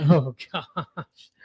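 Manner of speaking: real laugh through the words. Real, not acted. Co-host reacting to the story about an accent, laughing.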